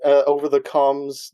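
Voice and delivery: ordinary talk, nothing marked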